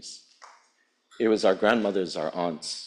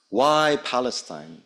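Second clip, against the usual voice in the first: ordinary talk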